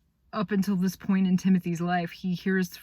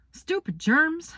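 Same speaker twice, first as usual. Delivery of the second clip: higher, slower, fed up with the germs; frustrated outburst; 11 semitones higher, 5 dB louder, slower than usual. Acted. Boy worried about his sick brother, lashing out in frustration. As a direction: spit it out in frustration.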